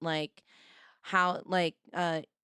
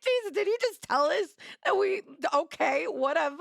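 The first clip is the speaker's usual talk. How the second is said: much higher, laughing yell of disbelief; laughing shout; 9 semitones higher than usual. Real, not acted. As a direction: cackle it out in shock. Reacting to gun law remark.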